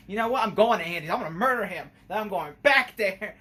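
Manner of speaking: imitating a pissed-off killer doll; angry Chucky. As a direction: fume it out like a furious doll. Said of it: "he was just pissed off".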